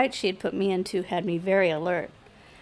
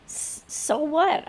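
slow, higher-pitched hesitant question; baffled stammer; 7 semitones higher, much slower than usual. A narrator's reading. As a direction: stumble into a puzzled question. Baffled man finally asks.

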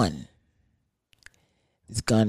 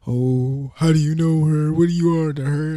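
lightly higher whiny imitation; mock whiny boyfriend; 4 semitones higher than usual. Mocking the boyfriend's whiny question. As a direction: whine it like a weak guy. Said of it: "went on to say".

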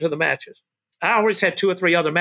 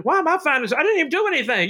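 indignant protest; 7 semitones higher than usual. Retold or imitated. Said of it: "I said".

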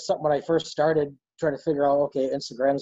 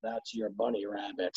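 dropped, softer voice for grandpa's punchline; low, quiet reveal; 6 semitones lower, 9 dB quieter than usual. Retold or imitated. Grandpa telling grandson what he just ate, low and quiet. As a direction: let it fall low and soft.

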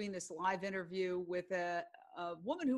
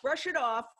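brisk self-command; 4 semitones higher, 5 dB louder than usual. Retold or imitated. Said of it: "But then I say".

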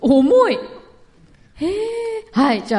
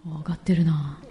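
whispered aside; 9 semitones lower, 6 dB quieter than usual. Real, not acted. Aside while bids climb.

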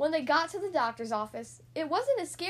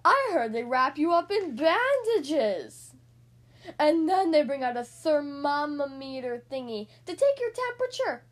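louder, spooky scare story; gasped warning; 7 dB louder than usual. Acted. Spike scaring Splat about the doctor, gasping with dread. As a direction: frighten your friend with rumours. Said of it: "gasped Spike".

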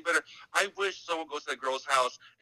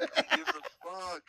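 much higher, slow squeaky mocking voice; 13 semitones higher, slower than usual. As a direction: keep the high mocking squeak, drawn out.

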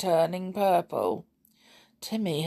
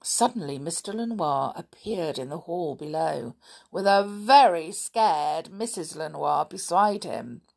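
ordinary delivery

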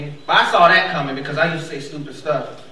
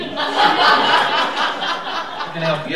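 stoned cashier; 7 semitones higher, much slower than usual. Retold or imitated.

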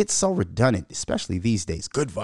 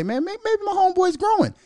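hopeful self-talk. Retold or imitated. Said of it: "I had to sit back and say".

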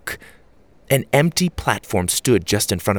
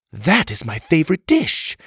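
higher-pitched, offended woman character; mildly acted annoyance, pitched up; irritable; 7 semitones higher than usual. A narrator's reading. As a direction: say it with indignant irritation. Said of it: "Achara said irritably".

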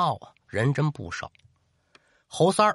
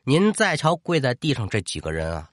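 ordinary delivery

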